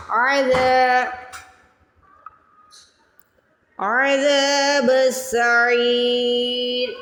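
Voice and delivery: عَذَابُ السَّعِيرِ with a throaty ain and long ذا; stretched ain and dhal. Shown as a demonstration. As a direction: recite عَذَابُ السَّعِيرِ slowly, drawing out ذا and عی. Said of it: "زال کو کھینچ کے ادا کرنا ہے". (عذاب میں عین کی آواز اور زال کھینچ کر)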